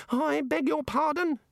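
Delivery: high slow affronted reply; high haughty little god; offended god. A narrator's reading. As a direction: huff at him.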